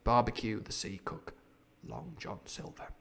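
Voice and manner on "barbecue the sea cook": plain reading voice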